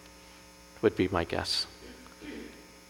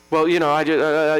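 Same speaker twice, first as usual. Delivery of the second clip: imitating a stammering, higher-pitched child; 6 semitones higher, 4 dB louder than usual. Grandson caught lying to his mother, stammering. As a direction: stumble over each word like a child caught out. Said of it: "and he's stammering".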